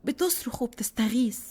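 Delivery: frightened. A narrator's reading. Girl tells mother her soul is trapped, frightened plea. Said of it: "أنا خايفة".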